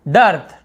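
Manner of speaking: डर्थ with an open "uh" vowel; डर्थ said alone, no "ee" or "ay"; model pronunciation. Shown as a demonstration. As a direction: punch डर्थ out loudly, "durth". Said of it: "यहाँ पे डिर्थ या डेर्थ नहीं बोल रहे हैं".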